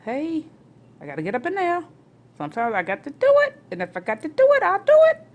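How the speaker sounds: climbing to a shout on "I'll do it"; enforcer. Real, not acted. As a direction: build up like the tough parent of the house. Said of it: "I come across as the enforcer".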